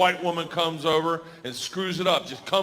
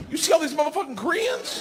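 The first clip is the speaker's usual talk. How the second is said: much higher, lightly voiced Sandy; voicing Sandy; 12 semitones higher than usual. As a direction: toss it off as a mock-shocked Sandy. Sandy's first words, acted out.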